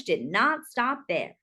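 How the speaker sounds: ordinary delivery, nothing marked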